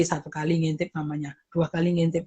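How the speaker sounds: low, quiet patient counting; soft parent bargaining. Retold or imitated. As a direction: gently set the rule for the child. Parent setting limits softly. (ibu menawar dengan pelan dan rendah)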